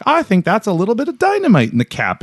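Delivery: high Maritime voice, casual about dynamite; East Coast accent. Retold or imitated. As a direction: play it like an unbothered East Coast local. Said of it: "McPherson's like".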